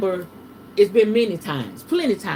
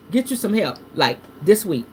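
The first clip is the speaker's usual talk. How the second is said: ordinary talk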